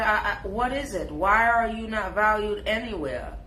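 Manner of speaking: ordinary talk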